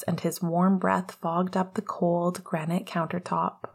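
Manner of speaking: ordinary delivery, nothing marked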